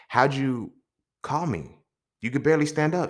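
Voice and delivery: high, astonished question; amazed friend. Retold or imitated. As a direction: marvel that the child called. Friend amazed child dialed.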